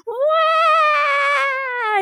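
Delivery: dying cat wail. Retold or imitated. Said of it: "He sounded like a dying cat".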